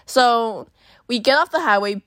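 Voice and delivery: normal talking voice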